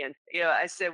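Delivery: ordinary delivery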